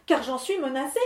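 the starving miller begging the wind lord; pleading miller. Acted. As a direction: ask meekly for anything.

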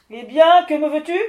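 loud impatient lord of the wind; gruff demand. Acted. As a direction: bark the question at the intruder.

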